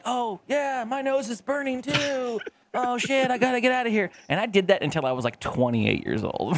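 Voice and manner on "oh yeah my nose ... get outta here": high, put-on fake agreement; faking along. Young [name] pretending to smell it, high and fake. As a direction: pitch it up and play along innocently. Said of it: "I'm like".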